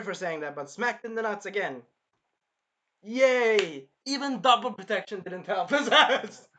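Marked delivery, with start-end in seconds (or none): 3.0-6.5 louder, laughing through the words; laughing cheer. Real, not acted. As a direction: cheer and cackle at his misfortune.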